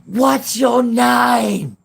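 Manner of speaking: high, very slow loud holler; imitated long-distance shout; calling across a field. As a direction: stretch it out in a high yell. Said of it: "literally across the field".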